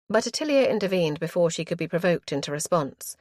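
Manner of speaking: ordinary delivery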